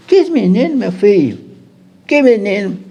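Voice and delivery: high, startled mother asking back; baffled mother. Retold or imitated. Mother baffled by son's question.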